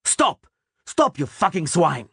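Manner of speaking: high, angry roar at deserters; furious roar. A narrator's reading. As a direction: stop the fleeing men cold. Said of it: "terrified by the roaring".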